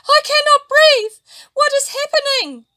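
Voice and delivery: high, loud, breathless panic; panicked gasp. A narrator's reading. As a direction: cry out in terror. Fish choking in polluted water.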